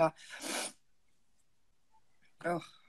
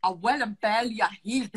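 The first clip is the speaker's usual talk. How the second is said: 1 faltering Scottish accent. Shown as a demonstration. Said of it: "Don't wanna do a Scottish accent".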